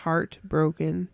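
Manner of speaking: mock heartbroken. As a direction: sigh it out like a shattered teen. Mock grief over the call.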